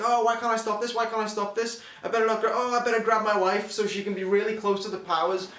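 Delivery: mocking the hero's fake despair, rising to a loud cry; mock panicked hero. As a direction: overact the doomed hero. Parodies hero's panicked lines.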